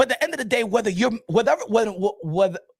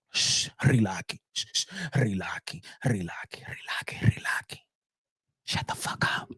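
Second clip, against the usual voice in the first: hushing whisper; 10 semitones lower, 7 dB quieter than usual. Acted. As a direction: hush the objectors down to a whisper. Hushing the objectors.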